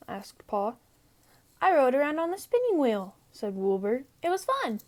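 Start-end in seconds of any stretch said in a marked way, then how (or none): 1.6-3.1 put-on high, quick, cheerful young sheep voice; Wilbur the lamb. 4.2-4.8 put-on high, quick, cheerful young sheep voice; Wilbur the lamb.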